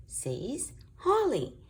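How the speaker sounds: plain reading voice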